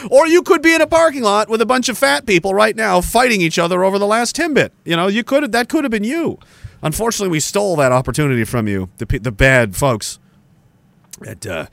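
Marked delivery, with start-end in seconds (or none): 0.1-6.4 high, sing-out sarcastic pitch; mock enticement. Teasing people who skipped the convoy, mock-enticing and high. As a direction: sell a miserable scene as a missed treat, pitched up.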